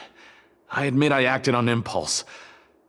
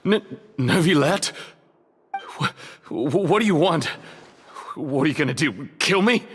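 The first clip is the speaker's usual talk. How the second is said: panicked stammer; 5 semitones higher than usual. Acted. Prisoner confronted by Neuvillette.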